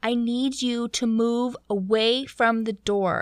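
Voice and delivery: slow, emphatic mock command; measured, projected order through a door; yelling captain. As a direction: imitate a captain making a scene, word by word. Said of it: "He's talking so loud and making a scene".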